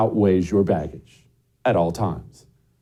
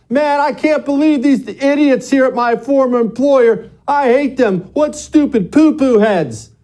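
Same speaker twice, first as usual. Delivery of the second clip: very high, silly tantrum voice; mock childish rant; 13 semitones higher, 4 dB louder than usual. Ex-employee blasting the former employer on Facebook, mock tantrum. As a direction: whine it way up high like a sulking child.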